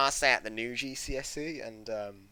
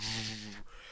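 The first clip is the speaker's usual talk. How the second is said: muffled speaker-distortion noise; imitated distorted speaker; 7 dB quieter than usual. Ex-pupil imitating the exam hall's bad speakers, muffled distorted noise. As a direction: imitate a crackling hall speaker. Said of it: "The speakers in there were like".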